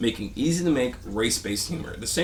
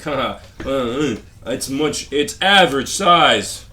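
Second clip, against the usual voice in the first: slow, drawn-out mock brag, ending much louder; mocking comic voice; 3 semitones higher, 8 dB louder, slower than usual. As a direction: ham up a comic's smug punchline.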